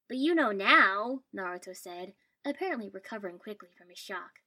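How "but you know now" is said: loud, high, stretched-out urging; eager insistence. A narrator's reading. Boy eagerly urges friend to come.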